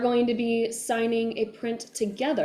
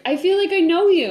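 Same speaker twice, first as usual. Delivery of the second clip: imitating an eager fan, higher and louder; 8 semitones higher, 8 dB louder than usual. Imitating a fan at an event approaching the actor, eagerly. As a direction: greet them like a fan finally meeting you. Voicing fans meeting her at events.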